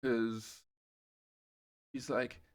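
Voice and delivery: normal talking voice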